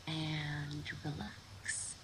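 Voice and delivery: soft, very drawn-out "and relax"; slow release cue. Real, not acted. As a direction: let "relax" sink slowly and quietly. Cue to release held tension.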